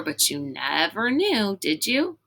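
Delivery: plain reading voice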